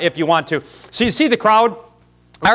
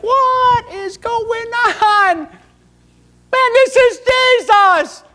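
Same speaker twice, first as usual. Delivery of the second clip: very high, slow mock-panicked squeal; shocked squeal; 5 dB louder, much slower than usual. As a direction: squeal like a man caught off guard. Man whipped by Jesus reacts.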